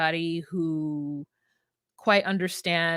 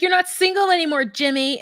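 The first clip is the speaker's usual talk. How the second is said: high, quick mock scolding; acted scolding; 8 semitones higher, 3 dB louder than usual. Imitating girlfriend telling off her partner, high mock scolding.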